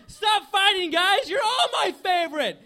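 high, loud pleading trainer's shout; a desperate cartoon hero begging them to stop; Ash pleading. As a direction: break up the fight like a frantic kid. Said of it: "on who Ash Ketchum likes the best".

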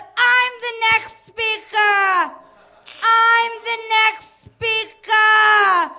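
long, drawn-out high bellow; screaming mom. Retold or imitated. Mom yelling back at the drive-thru sign, hammed-up scream. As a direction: bellow each word out slowly, hammed-up. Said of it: "she's, like, screaming".

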